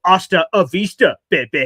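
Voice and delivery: loud mock movie tough-guy line; action-hero catchphrase. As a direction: fling out the family catchphrase. Family catchphrase recalled.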